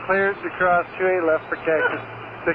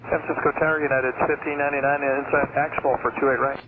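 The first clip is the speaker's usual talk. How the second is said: pilot over a crackly radio; air traffic control radio. Real, not acted. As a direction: narrowband cockpit radio transmission. Pilot calls tower over radio.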